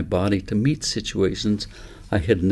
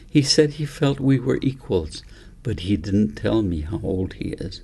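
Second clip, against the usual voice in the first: ordinary delivery, nothing marked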